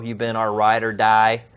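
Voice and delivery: normal talking voice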